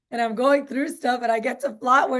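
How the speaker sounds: normal talking voice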